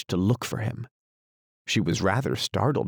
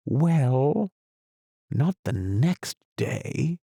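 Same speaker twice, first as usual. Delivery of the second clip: slow and thoughtful; 6 semitones higher, much slower than usual. A narrator's reading. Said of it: "the knight repeated as before".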